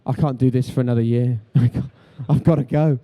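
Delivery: half-laughing, almost fleeing; laughing despair. Retold or imitated. Presenter losing it at his pundit, loud and laughing. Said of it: "I was like".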